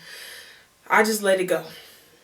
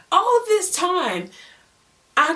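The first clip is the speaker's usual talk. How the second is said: stunned realization; 11 semitones higher, 4 dB louder, slower than usual. Real, not acted. Shocked realization.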